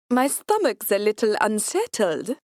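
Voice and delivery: light Austrian accent put on by the narrator; a hint of Austrian accent to fool the Nazi officer. Hostess pleading illness to the officer in a mild Austrian accent. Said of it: "putting on my best Austrian accent".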